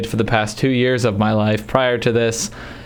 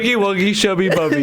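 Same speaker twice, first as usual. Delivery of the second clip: gibberish imitation; 6 semitones higher than usual. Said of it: "you said, like".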